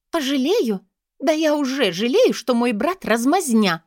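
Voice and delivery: high, loud, furious woman; angry cry. A narrator's reading. Sister scorning her weakling brother, crying out angrily. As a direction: throw it back at him in a rage. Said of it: "выкрикнула Амалия, гневно сверкнув глазами".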